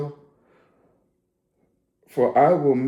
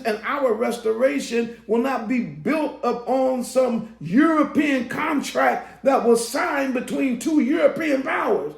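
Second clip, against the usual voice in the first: fiery preaching; 10 semitones higher than usual. Acted. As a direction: preach it to the crowd at full force. Preaching at full force.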